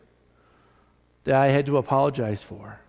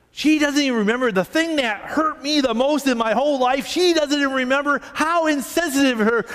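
high-pitched mock fuming, piling up grievances; livid, retold; 8 semitones higher than usual. Pastor imitating a church lady livid over an old hurt, high and indignant. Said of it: "all of a sudden she got livid".

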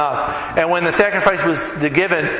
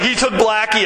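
raised pitch, mock dismay; higher retold lament; 7 semitones higher than usual. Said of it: "he's like".

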